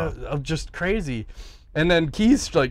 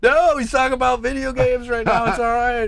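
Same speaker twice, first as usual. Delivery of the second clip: mock reassurance; 9 semitones higher, 4 dB louder than usual. Reassuring the startled friend.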